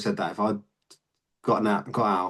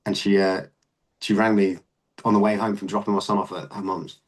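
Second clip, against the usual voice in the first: usual speaking voice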